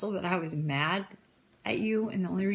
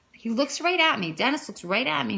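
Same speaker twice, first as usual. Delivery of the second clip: normal talking voice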